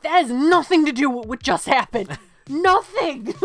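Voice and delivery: loud, emphatic disbelief; incredulous outburst. Real, not acted. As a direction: protest at the nonsense. Outraged at absurd ad.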